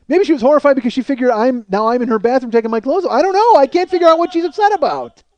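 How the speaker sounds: loud, worked-up confusion; exasperated rant. Acted. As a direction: climb into a shouted comic protest. Exasperated guessing at her upset.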